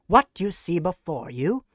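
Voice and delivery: usual speaking voice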